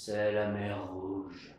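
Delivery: slow, low and ominous; dramatic. Acted. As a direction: draw it out darkly.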